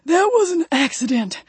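high-pitched, winded excuse; wheezed protest. A narrator's reading. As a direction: protest while catching your breath. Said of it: "Ray wheezed".

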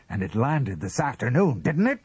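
insistent question climbing high; relentless pressing. A narrator's reading. Husband keeps at his wife, relentlessly pressing. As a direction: keep at it, pushing for a yes. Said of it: "he kept at her".

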